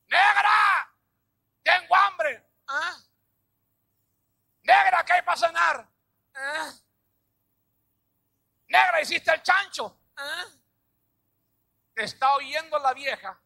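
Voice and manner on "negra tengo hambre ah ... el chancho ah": high, loud calls of a hungry husband; husband yelling. Retold or imitated. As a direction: bellow for the wife across the house. Husband shouting at ignoring wife. (marido gritándole a su esposa desde la sala)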